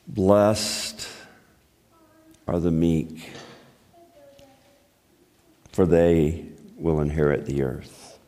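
hushed low reading of the Beatitude; solemn scripture reading. Acted. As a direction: read the verse with quiet reverence. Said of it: "let's learn".

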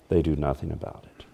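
"They do nothing about it" dropped to a near hush; soft repeat. Shown as a demonstration. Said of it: "repeated line, second much softer".

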